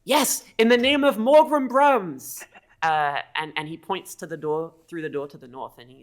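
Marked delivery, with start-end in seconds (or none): 0.0-2.4 high, loud, stretched-out cheer; zealous kobold. Acted. Kobold raising a glass to echo Morgan, zealous cry. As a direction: echo the battle cry with devotion. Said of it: "He holds up his glass and he says".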